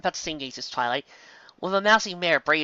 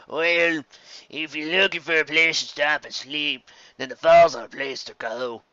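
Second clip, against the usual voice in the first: slow, lightly voiced old pony; nosy interruption; grizzled old stallion; slower than usual. Old stallion overhears and questions Twilight.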